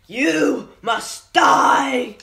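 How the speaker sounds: slow, high furious shout. Acted. Villain shouting at Jack Napier, furious. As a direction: roar the death threat.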